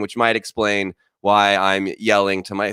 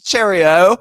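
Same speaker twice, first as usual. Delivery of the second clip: high, loud cartoon British greeting; mock British accent; 10 semitones higher, 4 dB louder than usual. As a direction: ham up a British accent for a clueless mom. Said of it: "how you say hello in British".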